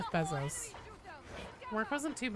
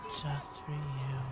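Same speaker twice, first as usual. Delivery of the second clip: softer, slow breathy-style tease; mock ASMR; 7 semitones lower, 5 dB quieter, slower than usual. Shown as a demonstration. Streamer teasing a viewer in ASMR voice. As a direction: lean in like an ASMR whisper. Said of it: "my A S M R voice".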